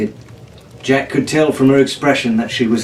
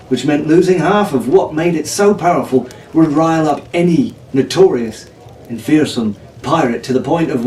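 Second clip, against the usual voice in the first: plain reading voice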